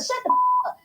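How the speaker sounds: imitating a high squealed put-down; mock squeal. Telling the man off on her live, in a high mock squeal.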